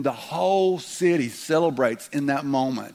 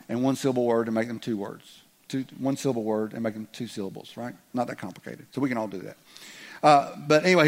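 ordinary delivery

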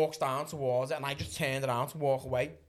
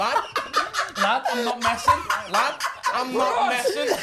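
high laughing insistence; 11 semitones higher, 9 dB louder than usual. Real, not acted. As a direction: say it through laughter. Laughing while telling the story.

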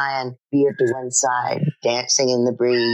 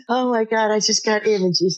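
laughing; 8 semitones higher than usual. Real, not acted. Laughing reaction.

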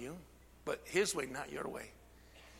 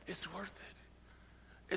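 hushed; 15 dB quieter, much slower than usual. Real, not acted. Hushed drawn-out repeat.

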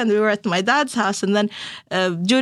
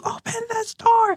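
much higher, desperate mock cry at a door; retold frantic plea; 12 semitones higher than usual. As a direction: plead to be let in, pitched way up. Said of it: "standing there crying and banging".

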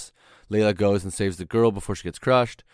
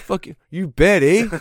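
hammed-up Canadian accent, higher and louder; 9 semitones higher, 7 dB louder than usual. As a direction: echo the jokey Canadian voice. Joking Canadian stereotype.

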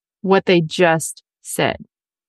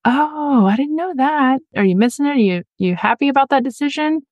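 gentle curious question; 6 semitones higher than usual. Shown as a demonstration. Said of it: "Your next question could be".

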